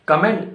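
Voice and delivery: "Comment" as kuh-MENT; wrong COMMENT. Shown as a demonstration. Teacher quizzing learners on "Comment", said as kuh-MENT. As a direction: say "Comment" with weak first vowel. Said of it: "It's not comment".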